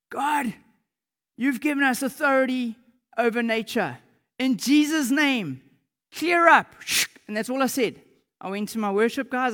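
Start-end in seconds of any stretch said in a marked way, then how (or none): 0.1-6.7 high, loud command to the sky; shouted prayer. Retold or imitated. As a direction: shout with authority at the rain, building to the last words. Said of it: "and I shouted".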